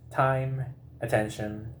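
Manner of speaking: usual speaking voice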